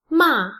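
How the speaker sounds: MA falling sharply from high; fourth tone. Shown as a demonstration. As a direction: drop the pitch quickly through "Ma". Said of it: "is the fourth tone".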